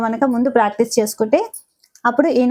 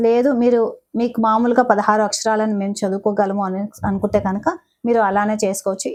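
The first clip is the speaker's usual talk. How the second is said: ordinary talk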